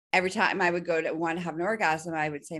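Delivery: usual speaking voice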